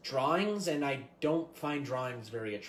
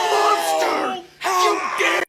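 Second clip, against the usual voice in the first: very loud, high shriek; outraged scream; 14 semitones higher, 14 dB louder, much slower than usual. Acted. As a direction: scream the accusation in horror. Horrified player screams at the killer.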